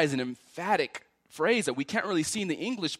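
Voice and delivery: ordinary delivery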